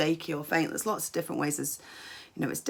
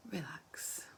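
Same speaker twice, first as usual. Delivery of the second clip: hushed, breathy release; relaxing cue; 14 dB quieter than usual. Shown as a demonstration. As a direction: let the word sink into a near whisper. Leading relaxation exercise.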